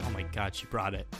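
ordinary delivery

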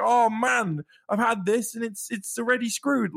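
exasperated mock lament; dismayed fans. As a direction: complain like a let-down customer. Quoting fans let down by defects.

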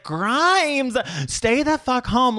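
high, slow, emphatic telling-off; exasperated scolding. Acted. Podcaster telling off [name] over covid, exasperated scolding. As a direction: scold her in drawn-out disbelief.

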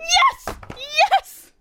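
high, ecstatic cries of yes; elated relief. Real, not acted. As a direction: squeal with relief. Correct answer, no shock.

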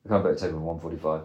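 low, soft, quick monotone mimicry; mock a brusque caller, low and hurried; flat, unfriendly. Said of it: "purely my tone that you didn't like".

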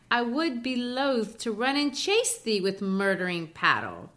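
lightly put-on Scottish accent from an American. Shown as a demonstration. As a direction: read the poem in a light Scottish lilt. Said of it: "try to read it in American Scott".

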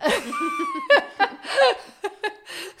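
high, loud burst of laughter; whooping high laugh; big laugh. Real, not acted. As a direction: let out a real belly laugh. Laughs at her own overspending.

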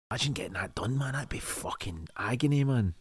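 hushed, breathy aside; real whisper, barely voiced. Real, not acted. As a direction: whisper it as if wincing at the screen. Whispering while watching a violent scene.

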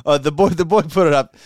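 put-on high, lilting Swedish accent; hammed-up Swedish accent. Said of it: "can you do Swedish accents".

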